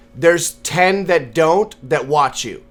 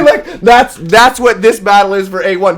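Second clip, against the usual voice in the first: normal talking voice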